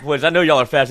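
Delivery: mock coach shout to the runners; coach calling out. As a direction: call out to the team like a coach.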